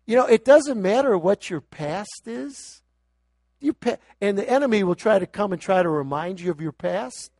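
fervent sermon voice; preaching. Real, not acted. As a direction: rouse them past their past.

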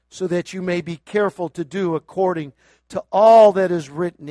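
loud scripture proclamation, slowing at the end; ringing preacher's read; preaching. Real, not acted. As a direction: declare the law over them.

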